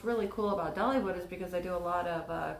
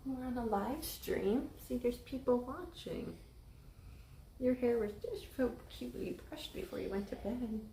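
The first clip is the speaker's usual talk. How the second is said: tender mom voice; 5 semitones higher, 6 dB quieter than usual. Real, not acted. Mother talking to sleepy child.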